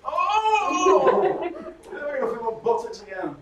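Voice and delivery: high, loud startled gasp, laughing; mock relief. Audience member rising from a conference chair, startled and laughing. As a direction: jolt up as if feeling returns to your seat.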